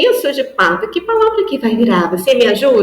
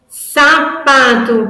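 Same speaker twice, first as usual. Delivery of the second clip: very slow, louder, sapato with all syllables; full word in syllables; 4 semitones higher, 5 dB louder, much slower than usual. Shown as a demonstration. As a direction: say sapato whole, slowly. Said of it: "Eu tenho a palavra".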